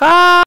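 sharp high mock scream; retold scream of a burning man; imitated scream. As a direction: let out a short high shriek. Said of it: "והוא צורח".

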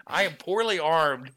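high, loud, slow declaration; mock proclamation. Hapless gun owner warning an attacker, mock proclamation. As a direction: announce it with hammed-up bravado. Mock announcement of being armed.

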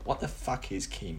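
inner thought aside. Retold or imitated. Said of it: "You're like".

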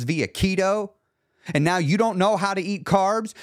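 real heated insistence, voice riding high; worked up. Real, not acted. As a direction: get really worked up on your soapbox. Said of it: "I got myself really worked up right there".

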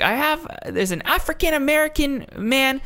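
high-pitched mock hysteria; freaking-out caller. Woman calling the police on a man, mock freak-out. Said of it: "just freaking out".

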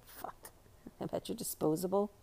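very soft, under-the-breath self-correction; muttered aside. Real, not acted. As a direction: mutter your slip back to yourself. Muttering over a reading slip.

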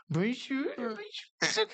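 high mocking Bonjour, bonjour; teasing bonjour. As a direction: tease with a sing-song Bonjour. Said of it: "tirando sarro".

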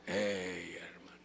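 whisper. Acted. Hushing aside to the congregation.

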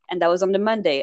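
ordinary talk, nothing marked

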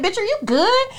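high put-on voice for the dog; the dog checking on her owner, high and worried; dog's worried voice. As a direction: give the dog a concerned high voice. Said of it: "looking up at me like".

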